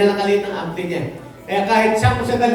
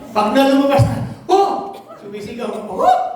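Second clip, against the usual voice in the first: playful mock cry of relief, pitched up; mock groaning shout; 4 semitones higher than usual. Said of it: "Sumisigaw ka pang ganyan". (pabirong sigaw ng ginhawa)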